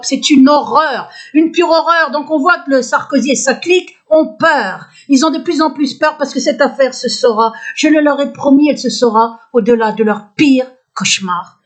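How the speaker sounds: high-pitched, indignant declaration; real defiant outrage; aggrieved protest. Real, not acted. Protests horror, promises exposure.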